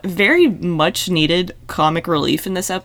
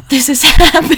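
louder, giggling through the words; laughing; 5 semitones higher, 8 dB louder than usual. Real, not acted. Friend laughs while mocking Burke's fiancée, giggling. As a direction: crack up mid-line.

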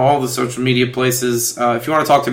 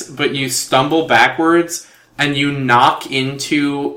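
normal talking voice

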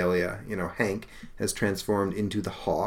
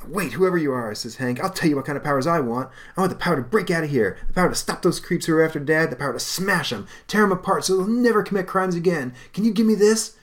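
higher, fast, building to loud demand; eager fury; 9 semitones higher, 4 dB louder than usual. Acted. Hank asking the spirit for smashing power, eager fury. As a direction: demand it hungrily.

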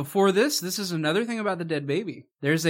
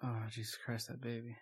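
much softer, flat and lifeless; mock deadpan line; 5 semitones lower, 18 dB quieter than usual. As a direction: drop it out quietly with no feeling. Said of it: "that was the line delivery".

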